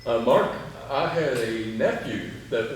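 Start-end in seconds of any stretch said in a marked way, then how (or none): none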